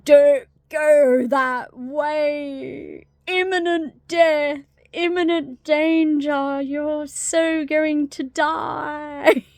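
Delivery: loud, high, drawn-out warning; slow, booming mock-ominous movie creature; doom-warning door. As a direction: wail a hammed-up prophecy of doom. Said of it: "if it was the tree or the door".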